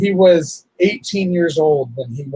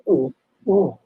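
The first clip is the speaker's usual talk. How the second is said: shaky crying breaths. Real, not acted. Breaking down crying.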